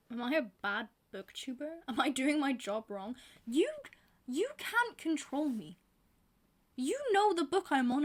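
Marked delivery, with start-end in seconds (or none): 0.0-1.8 laughing, breathy whispered question. Real, not acted. Booktuber asking viewers if she is bad, whispering while laughing. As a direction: whisper it like a guilty secret.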